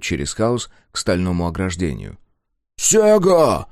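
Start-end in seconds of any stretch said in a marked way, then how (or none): 2.8-3.7 shout. A narrator's reading. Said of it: "выкрикнул следующий за Джобой дозер".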